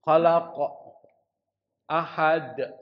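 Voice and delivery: bouncing final consonant in "Khalaq" and "ahad"; qalqalah. Shown as a demonstration. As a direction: echo the last q and d of "Khalaq, ahad". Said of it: "Oh, qalqalah". (pantulkan huruf akhir "Khalaq" dan "ahad")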